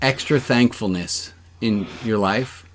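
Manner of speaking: ordinary talk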